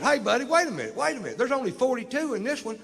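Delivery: high-pitched, agitated mock protest; high, urgent imitation of a baffled cop; agitated objection. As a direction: pipe up high in comic confusion. Confused cop interrupts his count.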